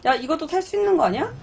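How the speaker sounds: ordinary talk, nothing marked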